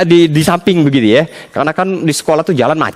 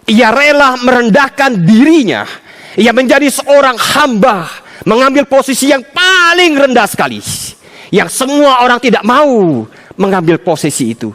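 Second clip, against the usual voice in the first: raised, fervent sermon voice; preaching; 9 semitones higher, 3 dB louder than usual. Acted. Preacher proclaiming God's love to the congregation, fervent.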